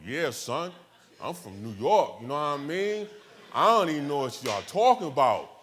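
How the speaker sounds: put-on loud, brash Brooklyn accent; punchy Brooklyn accent, projected. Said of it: "I go into my Brooklyn accent on them".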